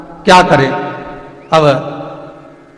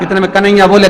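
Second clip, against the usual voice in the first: usual speaking voice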